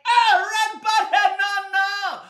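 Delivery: very high, loud, drawn-out scream; nightmare scream. Acted. Boy wakes screaming in the night, terrified scream. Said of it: "I woke up screaming".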